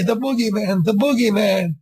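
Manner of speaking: put-on high, frightened child's cry; a scared kid wailing the name; kid screaming.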